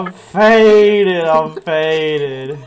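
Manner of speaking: loud yell. Real, not acted. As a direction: belt it out. Yells about being faded.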